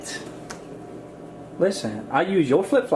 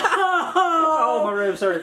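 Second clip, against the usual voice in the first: high laughing groan, ribs aching; worn out from laughing; helpless laughter; 9 semitones higher, much slower than usual. Real, not acted. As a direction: talk through laughter.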